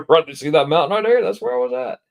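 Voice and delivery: loud, high, climbing exclamation with a laugh; retold excitement, pitch soaring; excited pointing out.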